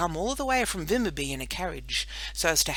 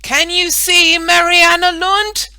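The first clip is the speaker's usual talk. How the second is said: much higher, much louder slow shout; 13 semitones higher, 17 dB louder, slower than usual. Acted. Boy shouting up to his sister on the flagstaff, much louder. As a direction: call up to the top of the pole. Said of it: "shouted Emil".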